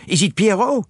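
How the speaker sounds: put-on high-pitched, French-accented challenge; Poirot demanding. A narrator's reading. Detective asks the watchers who it is, demanding. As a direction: push the question up insistently. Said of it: "demanded Poirot".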